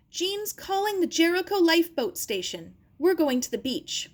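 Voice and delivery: high, loud urgent call; shouting. Acted. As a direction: shout the plan to her. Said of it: "her uncle shouted".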